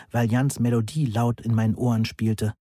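ordinary delivery, nothing marked

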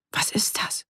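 hushed, unvoiced whisper; barely voiced, wary question. A narrator's reading. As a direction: whisper it as if listening out. Startled question while listening for noises.

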